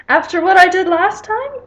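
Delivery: loud, high-pitched put-on British accent. Daughter protesting to her mother, in a loud British accent. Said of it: "like, a British accent".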